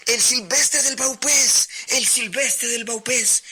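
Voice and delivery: high, loud shouted order over the storm; captain's shout. A narrator's reading. Captain shouting orders to desperate sailors in a storm, loud high shout. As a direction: bellow the command to the crew above the wind. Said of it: "Y el capitán gritaba".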